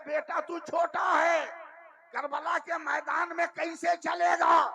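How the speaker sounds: high, loud, slow, wondering cry; astonished. Acted. Husain asking his infant son in astonishment, loud. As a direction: cry it out in amazement. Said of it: "حیرت سے کہا".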